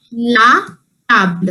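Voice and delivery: "la table" split by a pause, dropping low on table; French pronunciation demo. Shown as a demonstration. Teacher showing the pronunciation of the table, careful "la table". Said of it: "So the pronunciation is".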